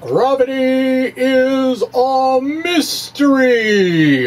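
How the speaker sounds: slow, high, drawn-out grand announcement; dramatic title reading. Shown as a demonstration. Announcing his blog title to listeners, slow dramatic announcer voice. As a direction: announce the title like a movie trailer. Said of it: "This is a dramatic reading of".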